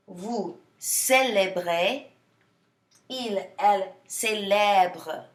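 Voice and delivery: célèbre said slowly with an open è, each form set apart; teacher slowly modelling je célèbre, tu célèbres for the class, drawing out each verb; slow model conjugation. Shown as a demonstration. Slow clear pronunciation model. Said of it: "you're hearing that eh sound".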